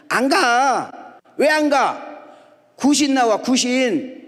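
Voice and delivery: put-on high, loud mother-and-boy spat; shouted refusal quarrel. Boy refusing his mother's night errand for fear of ghosts, shouted quarrel.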